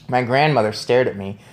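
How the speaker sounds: ordinary delivery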